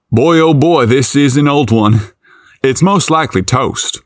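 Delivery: put-on light country accent. A narrator's reading. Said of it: "in his innocent country accent".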